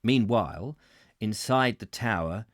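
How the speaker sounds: measured, projected audiobook reading; story narration. A narrator's reading. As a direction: read the memoir aloud with careful phrasing. Reads book chapter aloud.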